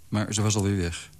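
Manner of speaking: ordinary delivery, nothing marked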